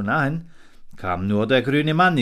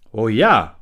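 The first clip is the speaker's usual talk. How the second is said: agreeing king; 9 dB louder than usual. A narrator's reading. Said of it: "pflichtete der Hirsch bei".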